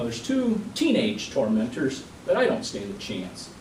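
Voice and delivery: ordinary delivery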